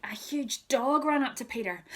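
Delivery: ordinary delivery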